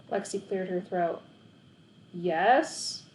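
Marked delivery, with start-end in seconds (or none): none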